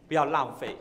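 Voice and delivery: ordinary talk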